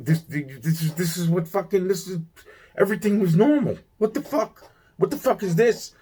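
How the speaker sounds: very high, stammering disbelief; bewildered protest. Retold or imitated. Answering the spirit who is mad at him, high flustered disbelief.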